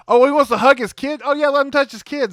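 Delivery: high, loud sarcastic concession; mock indulgence. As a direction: give in with exaggerated obligingness. Mocking officers indulging the captured killer.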